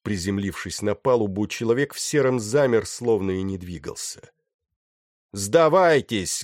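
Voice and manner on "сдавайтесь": drawn-out, raised shout to surrender; loud shout. A narrator's reading. Man in grey to the whole crew, shouting loudly. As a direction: shout it slowly for everyone to hear. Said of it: "громко закричал он".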